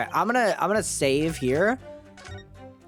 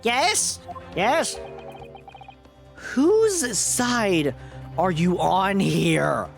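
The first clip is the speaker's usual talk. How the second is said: put-on high old professor voice; a puzzled old scientist asking, pitched up; very old man; 5 semitones higher, much slower than usual. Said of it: "the old man voice".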